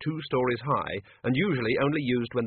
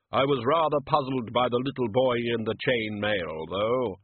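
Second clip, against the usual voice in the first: Death voice. Acted.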